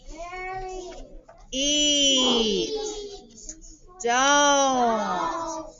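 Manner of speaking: teacher calling out a word list; word list read aloud. Shown as a demonstration. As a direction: read the list clearly for the class, one word at a time. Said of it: "Read them together".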